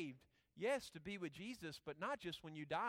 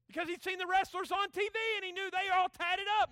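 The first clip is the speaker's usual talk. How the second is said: high, loud, excited storytelling; full-voice preaching; 12 semitones higher, 13 dB louder than usual. Acted. Preacher telling the congregation about TV wrestlers, full-voice preaching. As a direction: belt out the explanation in one high run.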